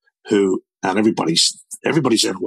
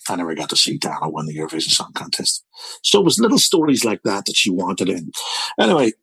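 ordinary talk, nothing marked

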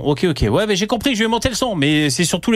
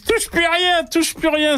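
voix aiguë; slower than usual. Retold or imitated. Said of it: "ils me disent".

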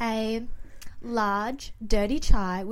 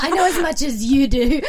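louder and higher through laughter; laughing reply; 5 semitones higher, 8 dB louder than usual. Retold or imitated. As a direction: laugh while saying it. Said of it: "And I'll be like".

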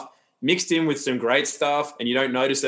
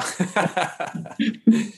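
short laugh; 5 semitones higher than usual. Real, not acted. Pleased laugh after praise.

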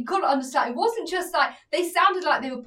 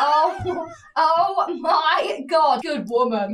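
louder, laughing exclamation; laughing delight; 4 semitones higher, 5 dB louder, much slower than usual. Retold or imitated. Watching the girl's TikTok: loud laughing approval. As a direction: cheer her on through laughter. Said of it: "And I'm like".

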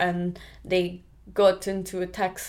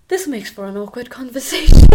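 louder, higher, laughing through the words; laughing aside; 4 semitones higher, 15 dB louder than usual. Real, not acted. Recalling an awkward bus chat, said with a laugh. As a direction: laugh it off as you say it.

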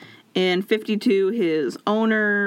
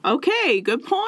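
high-pitched, sheepish backing down; mock meek concession; 8 semitones higher than usual. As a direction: give in meekly in a much higher voice. Voices racists backing down after shot.

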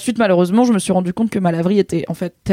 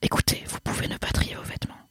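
soft ASMR whisper; right in your ear; 11 dB quieter than usual. Acted. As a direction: breathe the words close to the mic. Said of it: "une partie A S M R dans le podcast".

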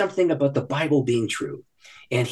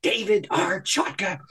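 mock scolding; 9 semitones higher than usual. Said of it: "She stomped her foot, fire came out of her eyes".

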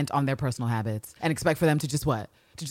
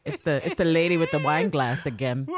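ordinary talk

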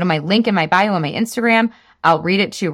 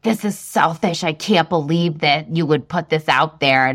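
imitating a troll; 4 semitones lower than usual. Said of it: "he's like".

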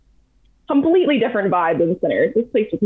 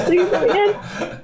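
higher, giggling mock begging; laughing plea; 10 semitones higher than usual. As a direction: beg to be let in through laughter.

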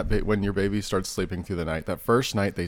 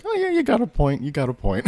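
agreeable; 8 semitones higher than usual. Retold or imitated. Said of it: "us being most like".

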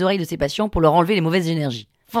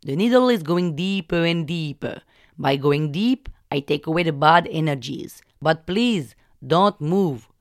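slow, insistent Indian English warnings; Indian-accented healer; slower than usual. Retold or imitated. Healer warns patient not to move, insistent Indian accent. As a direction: repeat the danger like a mantra, Indian accent. Vishnu explains needles.

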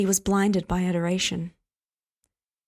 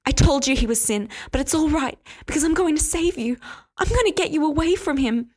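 higher, louder feverish conviction; frantic insistence; 7 semitones higher, 3 dB louder than usual. Acted. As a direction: urge him fervently, sure she saves him. Frenzied pleading, calling him sin.

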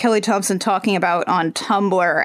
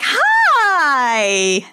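long drawn-out high "hi"; hammed-up greeting through a huge fake smile; fake cheery; 4 semitones higher than usual. Reader voicing two friends caught out, fake cheery greeting. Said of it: "huge fake smiles on".

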